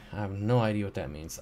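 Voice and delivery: usual speaking voice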